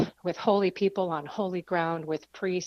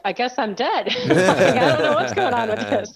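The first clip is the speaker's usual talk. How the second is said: laughing; 4 semitones higher, 8 dB louder than usual. Retold or imitated. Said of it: "he's like".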